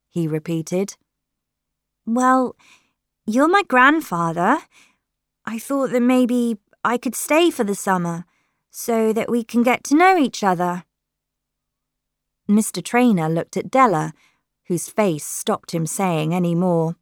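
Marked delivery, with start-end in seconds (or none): 2.1-10.8 higher-pitched hopeful granddaughter; hopeful Lily. A narrator's reading. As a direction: make an eager case, voice lifted. Lily asks grandfather to let her stay.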